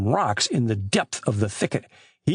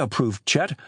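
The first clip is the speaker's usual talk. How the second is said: usual speaking voice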